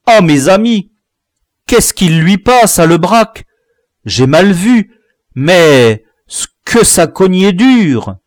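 put-on boy describing a brutal scuffle; worried boy's report. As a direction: tell it with alarm.

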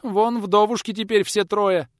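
high, slow, loud lament; tearful wail. A narrator's reading. Old woman mourns for the widows, tearful wail. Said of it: "Зашлась слезами та".

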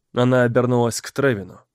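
usual speaking voice

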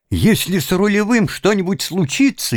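high urgent shout. Acted. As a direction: cry out the threat in alarm. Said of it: "крикнул Тим".

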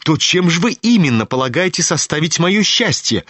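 a hint of a proud, sharp girl; Aglaya, demanding. A narrator's reading. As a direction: press the prince for the truth. Aglaya voiced in novel.

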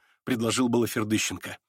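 ordinary delivery

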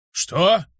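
angry disbelief. A narrator's reading. Luke hearing Adam's suspicion, angry disbelief. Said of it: "разозлился Люк".

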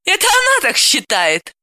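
mock the very idea; sarcastic smirk. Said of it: "саркастичной ухмылкой спросила".

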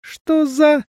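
high startled cry; surprised. A narrator's reading. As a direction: gasp it out in shock. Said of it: "только и успел сказать тень".

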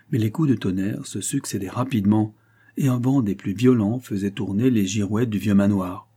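plain reading voice

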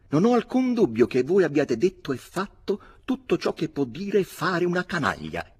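voce acuta e più forte, sdegnata; un giovane che interviene sdegnato; il piccolo Poynings. A narrator's reading. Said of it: "interloquì il piccolo Poynings". (Poynings accusa Barry, acuto e sdegnato)

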